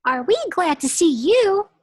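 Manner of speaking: high, loud cheer; overjoyed kids. Acted. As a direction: gush happily. Kids greeting teacher.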